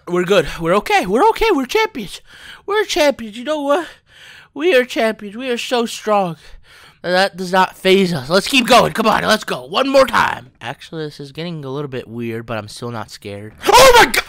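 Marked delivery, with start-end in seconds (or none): 0.1-10.5 high, loud self-rallying; frantic pep talk. Real, not acted. Player rallying to keep going after Vegemite, frantic pep talk. 13.7-14.2 very high, very loud shriek; terrified scream. Real, not acted. Player scared right after denying fear, terrified scream.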